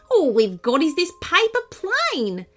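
a little bird sadly admitting it has nobody to play with; kookaburra voice. A narrator's reading. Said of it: "and the kookaburra said".

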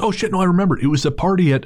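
high, excited put-on stranger; sudden recollection. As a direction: imitate him as the memory hits. Stranger suddenly remembering, retold.

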